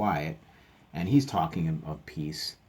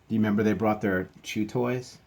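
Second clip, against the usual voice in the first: usual speaking voice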